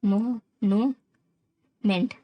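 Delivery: "Monument" very slowly, a pause after each syllable; "मो", pause, "नू", pause, "meant". Shown as a demonstration. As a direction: sound out "monument" for learners. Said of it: "कौन कौन सा syllable".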